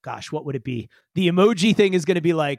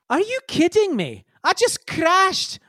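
much higher, slow exasperated whine; indignant Ace; 13 semitones higher than usual. Acted. Ace complains to the bot about just crashing, high exasperated whine.

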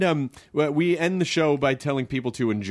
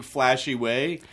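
usual speaking voice

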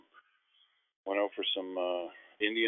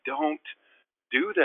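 higher, louder urgent objection; alarmed plea; 8 semitones higher, 4 dB louder than usual. Retold or imitated. Client hears agents' big demand, alarmed plea. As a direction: beg them to stop, pitched up.

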